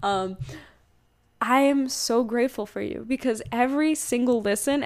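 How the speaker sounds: ordinary delivery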